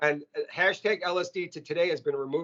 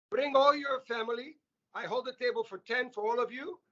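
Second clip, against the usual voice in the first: put-on a higher-pitched heavy Greek accent, father addressing the teachers; 5 semitones higher than usual. Greek father inviting teachers to his restaurant, heavy Greek accent. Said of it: "This with a heavy Greek accent".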